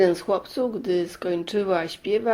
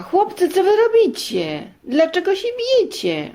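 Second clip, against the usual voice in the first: high, loud scolding mum; mother catching her sons fighting; 11 semitones higher, 4 dB louder than usual. Acted. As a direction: call out to stop the fight. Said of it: "i rzekła".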